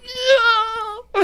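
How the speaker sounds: put-on very high wailing cry of a small child; kid's wail. Imitating a five-year-old wailing in the theater. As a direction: howl it like an upset toddler. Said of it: "this kid in the back going".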